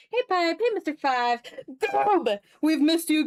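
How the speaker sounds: usual speaking voice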